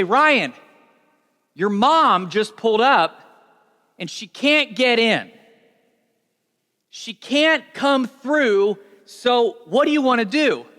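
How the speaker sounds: anxious party guest reporting news; urgent guest. Acted.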